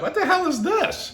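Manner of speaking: very high, far-off astonished cry; amazed disbelief. Retold or imitated. Friends gaping at ant heads, high astonished cry. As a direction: shriek the question in mock disbelief. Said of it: "So we're like".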